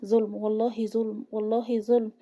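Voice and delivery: ordinary delivery, nothing marked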